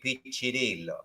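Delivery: Piccirillo with rolled Italian vowels; Italian pronunciation. Shown as a demonstration. Guest shows hosts the Italian way to say Piccirillo. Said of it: "the Italian way would be".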